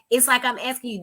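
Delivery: usual speaking voice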